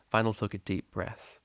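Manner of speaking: plain reading voice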